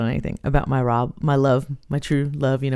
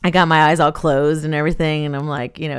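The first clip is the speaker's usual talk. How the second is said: ordinary talk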